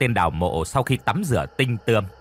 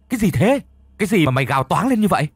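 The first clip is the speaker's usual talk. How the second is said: higher, louder, fast and insistent; rapid-fire demand; 7 semitones higher, 4 dB louder, faster than usual. A narrator's reading. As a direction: fire the question again, much higher. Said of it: "Lão hỏi dồn dập". (hỏi dồn, cao và to hơn, nói nhanh)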